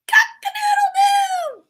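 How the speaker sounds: very high crowing cock-a-doodle-doo; rooster crow. Acted. Storyteller showing how a rooster crows, high crow. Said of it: "A rooster crows".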